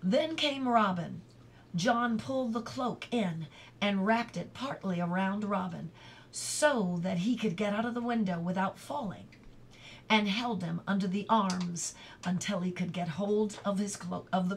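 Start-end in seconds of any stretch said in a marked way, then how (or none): none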